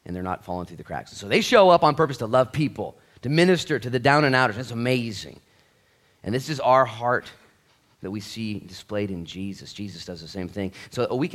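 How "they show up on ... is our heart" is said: loud, driving sermon, much higher; preaching at full force. Acted. Preacher urging the congregation not to look down on people, in full-force preaching. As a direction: preach it hard to the whole room. Praises Celebrate Recovery volunteers.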